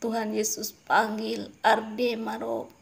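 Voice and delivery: usual speaking voice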